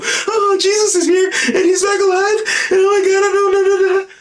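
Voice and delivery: very high, loud wailing falsetto; mock hysterical. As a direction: imitate a hysterical girlfriend, shrieking and half crying. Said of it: "she's all hysterical".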